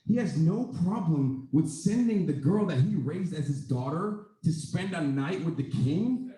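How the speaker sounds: ordinary talk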